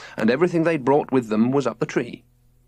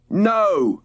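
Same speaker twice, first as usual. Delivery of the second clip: calling out; 8 semitones higher than usual. A narrator's reading. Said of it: "called Robert from his hammock".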